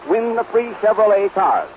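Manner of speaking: ordinary talk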